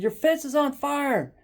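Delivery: high, loud, stretched-out warning; retold screaming. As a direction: imitate yelling urgent news to a neighbor. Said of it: "I started screaming".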